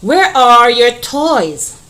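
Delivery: slow, loud read-aloud of the question; slow teacher reading. Shown as a demonstration. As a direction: read it out slowly to the class. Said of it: "All right".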